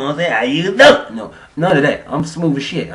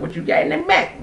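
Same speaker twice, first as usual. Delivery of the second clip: squeaky high question. Retold or imitated.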